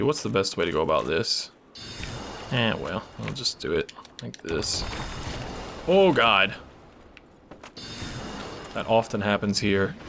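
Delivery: ordinary delivery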